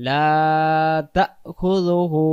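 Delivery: slow chanted recitation, long drawn vowels; Quran recitation. Shown as a demonstration. Teacher reciting Ayatul Kursi to students, slow chant. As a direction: chant each word slowly and melodiously.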